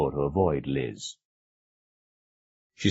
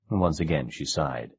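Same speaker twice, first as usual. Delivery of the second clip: usual speaking voice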